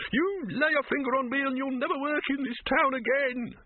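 high-pitched, strained shout. A narrator's reading. Silverfish threatens the troll grasping his leg, shouting. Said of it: "shouted Silverfish".

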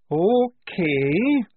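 high, drawn-out okay; subdued. A narrator's reading. Soldier seeing the dead in the crypt, subdued. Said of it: "said the subdued voice of Tonka".

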